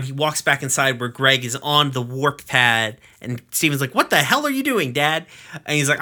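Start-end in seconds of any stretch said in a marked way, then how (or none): 3.9-5.3 alarmed. Retold or imitated. Steven alarmed at Greg on pad.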